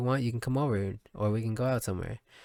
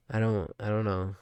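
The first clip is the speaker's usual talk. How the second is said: usual speaking voice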